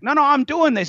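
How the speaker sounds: high-pitched insistent protest. Retold or imitated. Tony arguing back at the master, high protest. Student protesting in retold argument.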